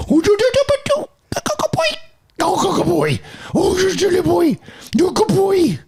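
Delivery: very high squeaky doggy praise; high baby talk to a dog. Pretend. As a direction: coo it way up high. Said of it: "Do it on the way out".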